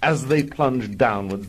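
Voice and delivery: ordinary talk, nothing marked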